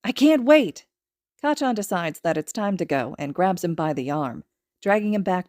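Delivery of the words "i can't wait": high, bright with excitement; can't wait, bubbling over; eager child. Acted. Little boy thrilled about his new quirk, high and eager. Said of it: "Izuku smiles".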